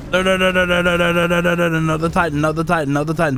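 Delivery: rapid-fire string of alarmed noes; panicked protest. Real, not acted. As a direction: blurt it over and over in panic. Another Titan appears mid-fight.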